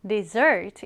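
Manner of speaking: "dessert" with the weight on its back half; stress on the second syllable. Shown as a demonstration. Teacher showing learners how to read "dessert", stress on the second syllable. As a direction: hit the end syllable of "dessert". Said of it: "강세를 뒤에 두어서".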